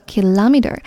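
kilometer as ki-LOM-eter; American kilometer, second syllable stressed; stress before L. Shown as a demonstration. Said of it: "重音在L前面读作".

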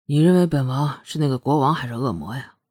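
deep, low-pitched prince; low but distinct. A narrator's reading. Prince asks how she sees him, low and distinct. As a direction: pitch it well down, each word clear. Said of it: "凌风的声音不高， 但字字清楚".

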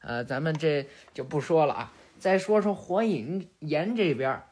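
plain reading voice

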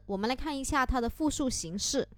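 ordinary talk

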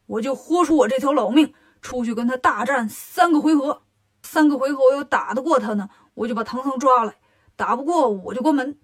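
loud, emphatic declaration; blustering resolve. Acted. Lion demon resolves to go out and fight, loud bluster.

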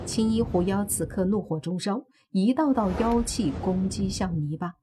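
plain reading voice

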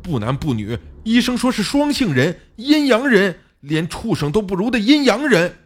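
high-pitched angry tirade; furious. A narrator's reading. Xiao Li blames his friend for the baby, enraged. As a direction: build from accusation to outraged shouting. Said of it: "小李的语气更加的愤怒".

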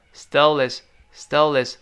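"stole his" joined as stol-is; linked, dropped h. Shown as a demonstration. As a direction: run "stole" into "his" without the h. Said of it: "读快了之后呢". ("stole his"连读不发h)

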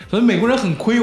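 normal talking voice

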